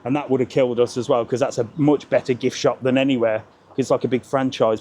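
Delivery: ordinary delivery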